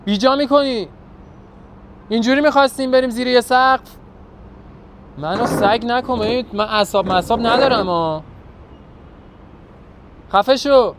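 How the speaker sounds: loud angry yelling into the phone; furious shouting. Acted. Jealous boyfriend yells at his girlfriend over the phone, furious. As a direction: lose it completely and yell.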